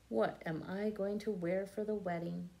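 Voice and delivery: usual speaking voice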